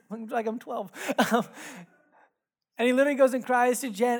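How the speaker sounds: ordinary talk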